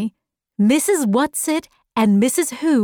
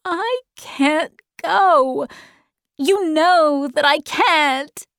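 high, slow, tearful protest; 6 semitones higher, slower than usual. A narrator's reading. Meg refuses as everyone looks, tearful high voice. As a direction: protest as if starting to cry, pitched up. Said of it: "and she started to cry".

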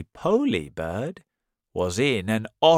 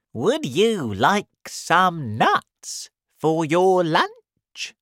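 high, loud, slow offer; storybook monkey's high, questioning lilt; helpful monkey; 9 semitones higher, 5 dB louder, slower than usual. Acted. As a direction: offer it brightly in a raised monkey voice. Monkey offers crocodile nuts.

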